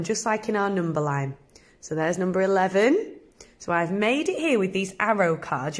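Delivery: ordinary talk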